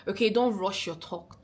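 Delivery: ordinary talk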